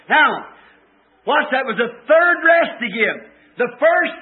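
emphatic, ringing sermon delivery; preacher proclaiming to a congregation; fervent preaching. Real, not acted. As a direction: drive each promise home with long stressed words. Preaches with fervour.

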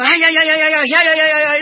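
squirrel chatter. Retold or imitated. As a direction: imitate a scolding pine squirrel. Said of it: "oh, what a noise".

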